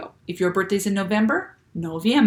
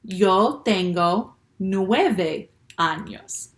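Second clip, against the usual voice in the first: slow read-along; much slower than usual. Shown as a demonstration. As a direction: draw each word out so kids can join. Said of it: "let's read it with nine".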